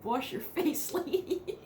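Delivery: high, teasing scold through a laugh; mock exasperated. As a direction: playfully tell her off. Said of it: "it's like".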